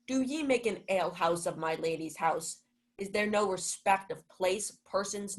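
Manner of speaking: ordinary talk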